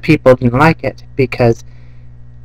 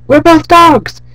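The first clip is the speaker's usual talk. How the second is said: much higher, louder, fast yelling; shouted dog chat; 8 dB louder than usual. Retold or imitated. Two dogs yelling to each other at night. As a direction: shout it gleefully across yards. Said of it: "And they're like".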